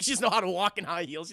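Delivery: usual speaking voice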